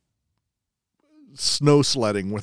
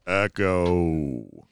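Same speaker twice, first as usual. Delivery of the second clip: softer, fading echo voice; cave echo; 5 semitones lower, 4 dB quieter, much slower than usual. Pretend. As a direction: let the words die away like an echo. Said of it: "This womb has an echo".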